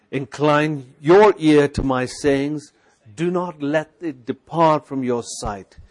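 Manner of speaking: measured, declaiming read to himself; scripture reading. Shown as a demonstration. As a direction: speak the word over your soul.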